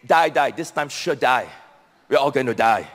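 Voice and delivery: low-pitched imitation of Singaporean gloom; Singaporean speak. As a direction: mimic a resigned Singaporean English speaker. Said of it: "typical Singaporean speak".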